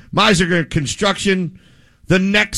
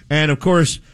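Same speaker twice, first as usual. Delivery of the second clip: ordinary talk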